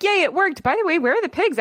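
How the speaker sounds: high, cheering; excited animals. Retold or imitated. The animals cheer their seduction plan, high excited. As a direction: celebrate like the trick just worked. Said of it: "all the animals are like".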